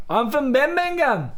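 slow, high mock Brummie; exaggerated Birmingham accent. Shown as a demonstration. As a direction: drag out a northern English accent. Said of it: "si te vas pa Manchester y pa Birmingham".

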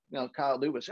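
usual speaking voice

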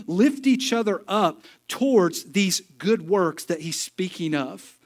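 rally the church from the pulpit; fired-up preaching. Acted. Preaching at full force.

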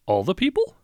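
high, loud disbelief; surprised. Acted. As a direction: leap up in surprise. Clerk surprised at order.

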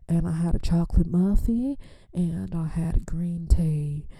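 hushed, breathy food list; sheepish whispered list. Real, not acted. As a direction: confess it under your breath.

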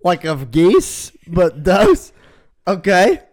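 mock the odd phrase with loud amused doubt; incredulous. Real, not acted. Mocks the phrase flock of does.

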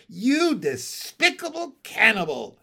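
loud, high, scathing accusation; hateful, emphatic outburst; cold hatred. A narrator's reading. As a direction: condemn him with hatred. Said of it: "I said with cold hatred".

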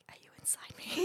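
breathless, awed whisper; whispered question. Retold or imitated. Asking the therapist in a spooked, awed whisper. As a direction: whisper it like she read your mind.

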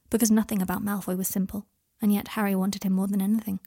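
ordinary delivery, nothing marked

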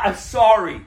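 ordinary talk, nothing marked

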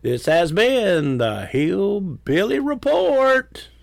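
high, slow announcer. Acted. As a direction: announce the show like radio. Show sign-off.

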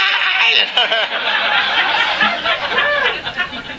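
big high burst of laughter; long falling laugh. Real, not acted. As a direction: laugh at the memory of the ride. Laughing after the wheelchair ride.

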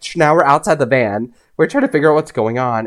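plain reading voice

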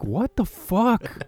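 slow, drawn-out disbelief; mock horrified viewer reaction; stunned what the fuck. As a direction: let the shock stretch each word. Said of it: "You're like".

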